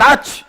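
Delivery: sudden loud high sneeze. Acted. Hiding man gives himself away, sneezing loudly. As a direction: burst out a sharp sneeze. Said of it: "he hears the sneezing sound".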